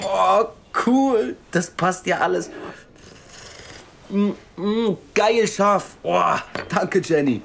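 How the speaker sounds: high, loud delight over food. Acted. Thanking waitress Jenny for the donuts, high loud delight. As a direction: gush over the treats.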